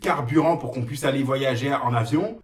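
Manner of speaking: high ranting voice; mock rant. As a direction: rant at the viewers. Sudden ranting rant persona.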